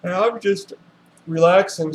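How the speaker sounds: through a yawn. Real, not acted. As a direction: yawn while you talk. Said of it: "so I'm yawning a little bit".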